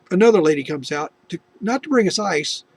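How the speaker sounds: ordinary talk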